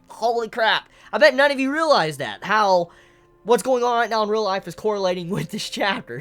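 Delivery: loud high excited rush. Real, not acted. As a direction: blurt it out, thrilled.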